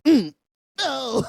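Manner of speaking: ordinary talk, nothing marked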